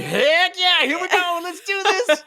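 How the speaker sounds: high, laughing hype; squeaky excited cheer; eager cheer. Retold or imitated. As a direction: pump yourself up, way up high and laughing. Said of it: "And I'm like".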